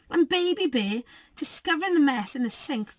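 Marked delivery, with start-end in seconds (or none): none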